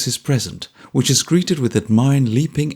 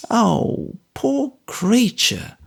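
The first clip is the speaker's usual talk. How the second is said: high, slow motherly pity; Mrs Bagnet, pitying; 11 semitones higher, much slower than usual. A narrator's reading. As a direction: sigh it out like a kind soldier's wife.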